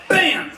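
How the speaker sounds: shouted high bam like a gunshot; loud bam. Shown as a demonstration. Host demonstrates the gunshot with a loud bam. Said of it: "That was bam".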